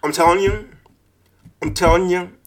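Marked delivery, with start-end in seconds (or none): none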